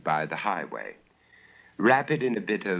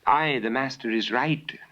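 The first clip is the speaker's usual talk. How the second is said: higher, lightly voiced young maid; a hint of an eager little maidservant agreeing; maidservant Bella; 6 semitones higher than usual. Maidservant agreeing with her master, lightly voiced higher. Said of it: "declared the little maidservant Bella".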